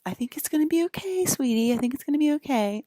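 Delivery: high, fast imitation of mom soothing; mom reassuring. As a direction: lightly mimic a mother calming her kid. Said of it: "my mom's like".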